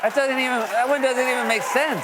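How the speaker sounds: loud, incredulous outburst; exasperated shout. Acted. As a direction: shout it in disbelief. Exasperated shout at absurd claim.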